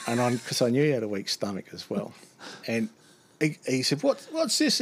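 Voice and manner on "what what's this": alarmed squeal. Retold or imitated. Driver panicking at the bag.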